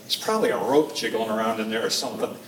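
low, understated, dismissive dad voice; skeptical dad. Retold or imitated. Dad doubting his son's snake claim, low skeptical murmur. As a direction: play down the scare like a doubting father. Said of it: "looks at me kind of skeptically".

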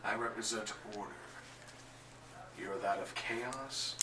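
soft, grave and restrained; quiet, cold resolve before the fight; hushed hero. Acted. Token calmly warning Lobo before the duel, hushed.

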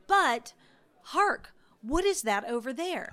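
loud, lifted theatrical call; dramatic hark. Acted. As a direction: grab attention like a herald. Game master points out something.

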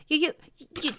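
high, loud, stuttering accusation; spluttering. A narrator's reading. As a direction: splutter as if through soapsuds. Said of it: "spluttered Piglet".

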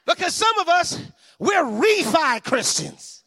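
loud, high preaching climax. Acted. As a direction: preach at full force over the music. Climactic preaching over music.

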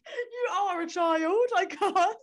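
high, slow words through laughter; laughing hard. Real, not acted. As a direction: crack up as you speak.

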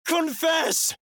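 high piercing screamed word. Acted. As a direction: scream it to cut through noise. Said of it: "Eight screams so loud".

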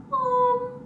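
put-on high whiny kid calling out; kid calling Mom. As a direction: call like a whining child. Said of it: "It's like he's here with me now".

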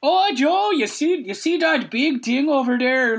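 high, slow gawking tourist voice; Canadian tourist. Pretend. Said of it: "our best impression of a Canadian tourist".

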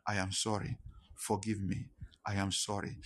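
low, soft, subdued apology; retold tearful apology. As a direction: retell a crying apology quietly, without tears. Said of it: "I was crying saying it".

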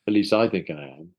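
normal talking voice

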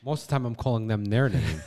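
ordinary delivery

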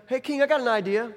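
comic attendant chiming in; palace attendant. Pretend. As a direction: pipe up to the king with an idea. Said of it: "there's this attendant in the room".

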